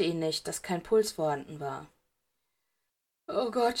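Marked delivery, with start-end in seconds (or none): 3.3-3.8 lightly voiced sob. A narrator's reading. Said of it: "schluchzte Draco".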